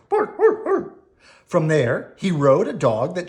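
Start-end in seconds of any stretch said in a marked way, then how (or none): 0.1-1.0 high yappy barks; barking dog. Acted. Dog barking at the squirrel, high yaps. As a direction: yap like a small dog. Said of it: "a dog said".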